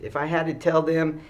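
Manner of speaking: ordinary delivery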